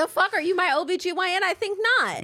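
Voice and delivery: high, fast mock outrage; indignant retort. Woman rebuffing nosy urgent care provider, indignant.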